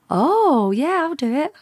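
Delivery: high, sing-song yes; mock-eager agreement. Officer says yes to a course she knows nothing about, mock-eager.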